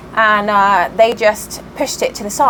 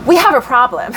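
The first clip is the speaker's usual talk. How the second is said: attempted American accent; 3 semitones higher than usual. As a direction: put on an amateur American accent for a neighbour's complaint. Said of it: "Can't do an American accent".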